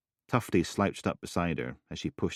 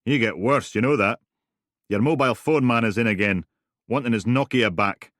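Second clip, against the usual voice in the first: grumbling Big Gary; 5 semitones higher, 7 dB louder than usual. Acted.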